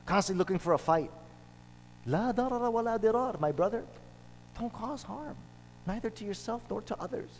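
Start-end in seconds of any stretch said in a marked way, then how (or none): 2.1-3.4 fast recited maxim; quick Arabic recitation. Acted. Preacher quotes the maxim to his brothers, rapid recitation. 4.6-7.3 much softer, higher gentle advice; soft counsel. Real, not acted. Preacher gently advises his brothers not to harm, softly. Gentle admonition after maxim.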